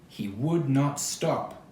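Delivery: ordinary delivery, nothing marked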